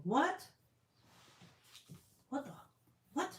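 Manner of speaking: high, startled what; reading a shocking comment, startled; shocked what. Real, not acted. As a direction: react in real disbelief.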